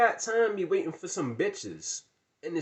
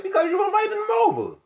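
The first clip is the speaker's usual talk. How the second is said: much higher, louder shout; exasperated yell; 9 dB louder, faster than usual. Retold or imitated. Yelling at friend who invited the clowns, exasperated. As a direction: blow up at him.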